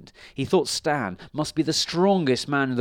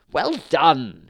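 loud, raised storybook cheer; gleeful cry; 4 semitones higher, 8 dB louder than usual. A narrator's reading. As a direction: cry out congratulations. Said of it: "she cried".

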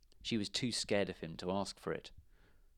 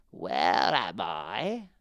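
calling out; 4 dB louder, slower than usual. A narrator's reading. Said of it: "called out".

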